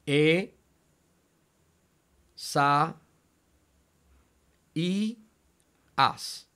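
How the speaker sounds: "E, sa, i, as" with long pauses, the "i" lifted; syllable by syllable. Shown as a demonstration. Teacher showing the split diphthong, "E, sa, i, as" syllable by syllable. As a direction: break "E, sa, i, as" into four isolated syllables. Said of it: "cómo dividió el dictongo".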